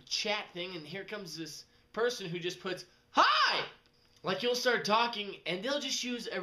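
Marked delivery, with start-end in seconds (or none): 3.1-3.6 squealed, much higher and louder Hi; overexcited hi. Retold or imitated. Chat friend pops up with an overexcited squealed greeting. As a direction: shriek it like a bubbly chat greeting.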